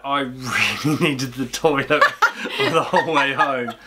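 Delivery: laughing through the words; slow the words down as laughter breaks in; amused, laughing admission; laughing confession. Real, not acted. Laughing while confessing toilet urgency.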